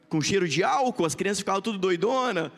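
ordinary talk, nothing marked

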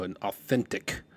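AUTHENTIC leaned on heavily, set apart; imitating a friend stressing AUTHENTIC; emphasis on AUTHENTIC. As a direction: put a lot of weight on authentic. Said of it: "put a lot of emphasis on that word".

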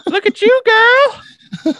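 squealed high and loud, laughing; high squealing tease. Real, not acted. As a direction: squeal it like teasing a friend. Teasing squeal at friend.

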